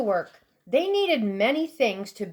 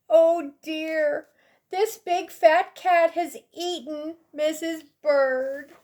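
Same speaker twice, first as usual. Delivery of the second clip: mild crying; 5 semitones higher than usual. Acted. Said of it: "Mister Bird began to cry".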